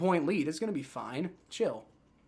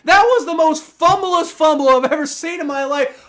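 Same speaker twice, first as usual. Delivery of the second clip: furious yell; 9 semitones higher, 9 dB louder than usual. Real, not acted. Shouted complaint, later repeated calmly.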